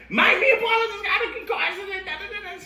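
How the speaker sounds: high, rushed panicked phone report; frantic call. Retold or imitated. Panicked call to Paula's mom.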